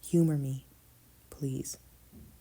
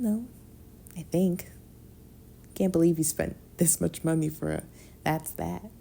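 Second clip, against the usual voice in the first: ordinary delivery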